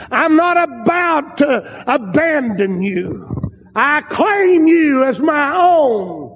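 slow, high, insistent proclamation; fervent preaching. Acted. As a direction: declare ownership with stretched, weighty words. Preaches in God's words.

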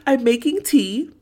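high mock whimper of a sad girl; mimicking Emma. Co-host mimics Emma's teary line, high. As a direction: play the poor girl making tea, high. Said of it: "And she's like".

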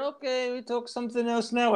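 a hint of a Turkish accent, backing off. Turkish accent, the Turkish buyer changing the subject. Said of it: "the Turkish guy went".

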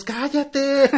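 high, loud mock yell; retold crowd shout. Retelling everyone silencing her, loud mock yell. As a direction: yell it like a fed-up audience, hammed up.

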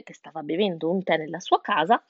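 plain reading voice

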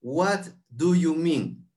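each word drawn out slowly; slow demo. Shown as a demonstration. Teacher slowly models the question for students, drawn out. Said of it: "Hay una frase nueva".

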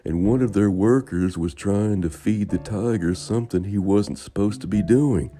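loud, high zookeeper voice; bored zookeeper voice. A narrator's reading.

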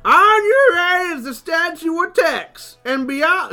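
loud, high tour-guide call; big hollered riverboat guide spiel. Acted. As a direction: slowly belt it out to the whole boat. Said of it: "if you would read for the guide".

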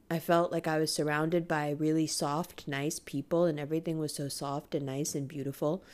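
gentle nostalgic murmur; soft, tender memory. Real, not acted. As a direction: drift off, almost hushed. Reminiscing about safe feeling.

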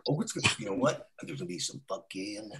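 sleazy hushed come-on. Retold or imitated. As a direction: lean in and murmur it low. Whispered sleazy promise.

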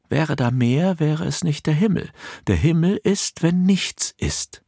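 high, floating spirit voice, slowing at the end; airy otherworldly being. A narrator's reading. The Unwesen tells Nicolina that heaven is nothing, airy and high. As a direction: sing it out like a serene ghost who knows better.

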